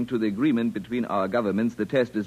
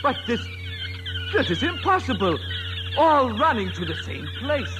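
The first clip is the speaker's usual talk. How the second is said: astonished; 12 semitones higher than usual. Acted. Alien astonished at the trap.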